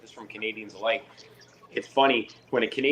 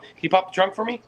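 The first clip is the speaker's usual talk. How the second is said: mock officer request; 10 semitones higher, 5 dB louder than usual.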